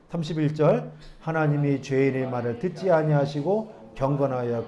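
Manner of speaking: slow, measured Bible reading voice; scripture reading. Acted. Pastor reading the verses to the congregation, measured and formal. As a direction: read the verses out to the church.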